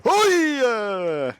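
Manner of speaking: sudden high call to hail a cab; taxi call. Pretend. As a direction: shout it out like hailing a taxi. Hailing a taxi mid-sentence.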